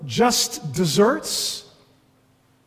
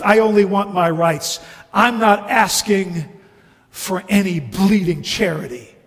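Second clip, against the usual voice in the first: aggrieved ghost; 3 semitones higher, 5 dB louder than usual. Acted. Ghost demanding his rights from the bright man, aggrieved. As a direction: insist indignantly, pushing each phrase.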